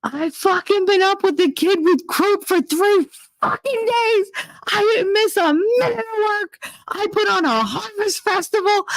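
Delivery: high, shrill-pitched yelling tirade near tears; furious rant. Retold or imitated. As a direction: scream it at her, voice cracking. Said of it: "I was like".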